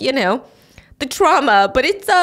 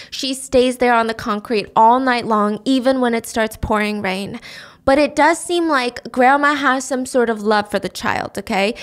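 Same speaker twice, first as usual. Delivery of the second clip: ordinary talk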